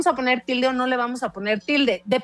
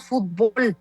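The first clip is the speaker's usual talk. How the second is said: fútbol said "fut-BOL"; stress on last syllable. Shown as a demonstration. Guest showing Mexican usage, fútbol stressed as fut-BOL. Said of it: "como una palabra aguda".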